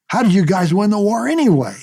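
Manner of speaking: a high, lightly voiced kid teasing the pilot; Peter's letter teasing the pilot who keeps missing, kid voice; cheeky boy. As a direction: needle him like a sassy nine-year-old. Nine-year-old boy's letter.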